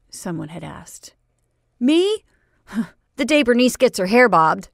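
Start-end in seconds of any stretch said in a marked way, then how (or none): 1.8-4.7 high, loud laughing jab; laughing retort. Acted. Boy's joking retort.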